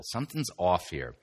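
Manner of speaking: ordinary delivery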